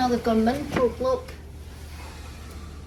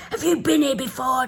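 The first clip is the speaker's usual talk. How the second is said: lightly put-on American accent, higher; American-accent taxi driver; 4 semitones higher than usual. Taxi driver quizzing his passengers, mock American accent. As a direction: imitate a cabbie in American accent. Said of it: "doing this in, like, an American accent".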